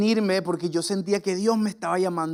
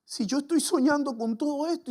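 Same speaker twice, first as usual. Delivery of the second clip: retold crying; 6 semitones higher, 5 dB quieter than usual. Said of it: "me empecé a llorar".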